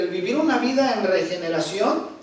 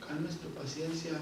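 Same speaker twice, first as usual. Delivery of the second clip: usual speaking voice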